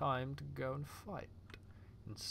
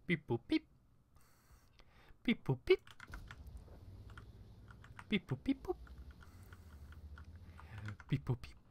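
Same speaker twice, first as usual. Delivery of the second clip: robot beeps. Pretend. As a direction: chirp it out as a playful robot. Mimics the bot he greets.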